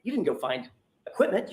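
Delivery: ordinary talk, nothing marked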